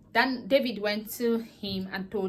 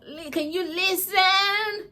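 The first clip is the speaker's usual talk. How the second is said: high, loud, slow sing-song outburst; mock exploding in frustration; exploding at her; 10 semitones higher, 6 dB louder, much slower than usual. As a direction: boyfriend cutting off the girlfriend's rant, loud and drawn out. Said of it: "he exploded on her".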